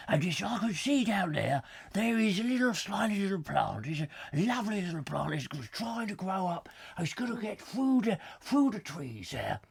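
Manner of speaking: put-on nature presenter, mangling the words; David Bellamy impression. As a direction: enthuse over a tiny plant in a hammed-up presenter voice. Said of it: "I can't do David Bellamy".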